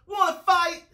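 high, loud dare; pugnacious bug challenge; grouchy ladybug. A narrator's reading. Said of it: "said the grouchy ladybug".

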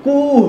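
কুহ with a long, drawn-out u; কুহ said with a long u sound; long u vowel. Shown as a demonstration. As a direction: stretch the u in কুহ. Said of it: "দেখুন আমরা উচ্চারণ করছি উ হিসাবে".

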